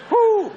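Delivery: high loud whoo; whoop. Real, not acted. Preacher whoops at the manager's flaming fit, loud. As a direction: let out a whoop.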